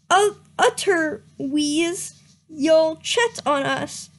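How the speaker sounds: usual speaking voice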